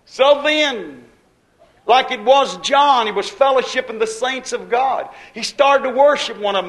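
preaching. Acted. Preacher retelling John and the saints, preaching. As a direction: build the point with fervour.

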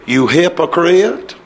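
low, slow, softened mock accusation; mock mild rebuke. Shown as a demonstration. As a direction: draw it out low, as if unwilling to offend. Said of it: "He didn't say it that way".

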